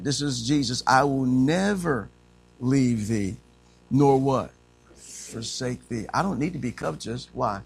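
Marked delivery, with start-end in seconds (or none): 0.8-6.1 slow, measured reading of the promise; solemn slow scripture reading. Acted. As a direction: read each word weightily.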